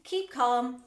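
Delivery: loud call for calm; crying out. A narrator's reading. Factory owner to frantic mother, crying out. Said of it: "cried Mister Wonka".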